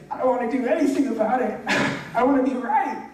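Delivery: high-pitched, hammed-up stubborn protest; mock childish refusal. Preacher replays refusing his coach's question, mock petulant.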